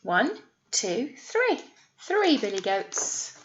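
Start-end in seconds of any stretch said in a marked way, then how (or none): none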